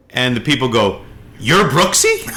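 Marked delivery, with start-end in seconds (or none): 1.4-2.3 high, incredulous question; imitating amazed recognition, pitched up; mock astonishment. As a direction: ask it like a starstruck stranger. Acted astonished strangers recognising wife.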